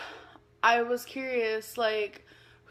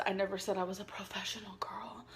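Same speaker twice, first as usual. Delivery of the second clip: whispered aside; 3 semitones lower, 11 dB quieter, faster than usual. Real, not acted.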